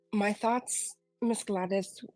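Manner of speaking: ordinary talk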